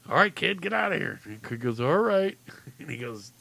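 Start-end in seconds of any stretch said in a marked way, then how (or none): 0.1-1.2 high, impatient send-off; dismissive clown. Acted. Clown sends the refusing boy away, dismissively. 1.8-2.4 put-on high, loud, exasperated kid agreement; reluctant giving in. Boy gives in to the clown, reluctantly.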